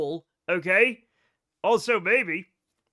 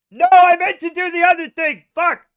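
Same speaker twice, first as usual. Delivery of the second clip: higher, louder frustrated yell; 7 semitones higher, 11 dB louder than usual. Real, not acted. Streamer yells at his game mistake, loud and frustrated.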